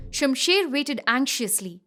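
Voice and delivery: ordinary delivery, nothing marked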